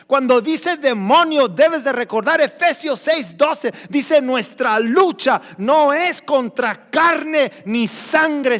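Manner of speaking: loud, high-pitched preaching; full-force preaching. Real, not acted. Preacher warning the congregation about demons, full force. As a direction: drive each point home at full volume.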